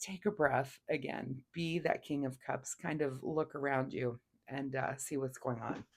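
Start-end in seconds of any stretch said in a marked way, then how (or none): none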